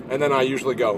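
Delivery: normal talking voice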